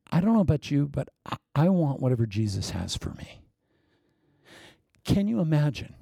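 ordinary delivery

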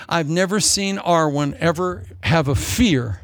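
ordinary talk, nothing marked

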